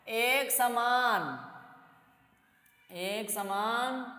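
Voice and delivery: very slow, spaced-out dictation pace. Shown as a demonstration. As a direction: say it slowly so students can write. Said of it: "ये अर्थात दूसरा point". (धीरे-धीरे बोलकर लिखवाना)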